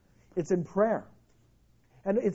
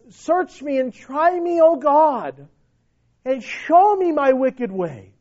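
fervent plea; 4 semitones higher, 4 dB louder, slower than usual. Acted. Preacher voices psalmist's plea.

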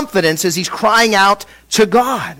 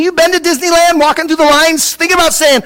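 high-pitched, animated disbelief; incredulous; 8 semitones higher than usual. Acted. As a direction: push the pitch up, as if it's absurd. Preacher mocks asking this in crowds.

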